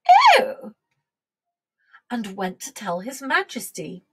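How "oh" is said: very high, loud "oh"; surprised queen. A narrator's reading. Queen hears the dairymaid's suggestion, surprised.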